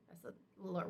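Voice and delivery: usual speaking voice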